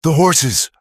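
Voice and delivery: high loud alarmed yell; urgent shout. A narrator's reading. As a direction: cry out about the horses. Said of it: "Luke yelled".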